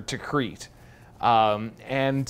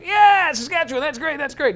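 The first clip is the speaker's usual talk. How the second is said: much higher, eager and chummy general voice; excited Texan general; 5 dB louder than usual. Retold or imitated. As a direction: play the little Texan general, thrilled to meet them. Retold general from Texas talking.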